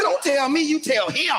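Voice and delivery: high indignant retort at the coach; father to coach, high mock indignation.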